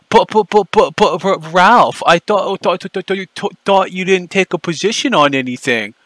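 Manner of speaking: high, stuttering mockery of a flustered man; sneering high stutter aimed at Ralph; mock stammer. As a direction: stammer out each word like he's caught out.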